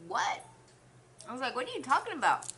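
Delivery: confused disbelief. Retold or imitated. Confused reply to coworker.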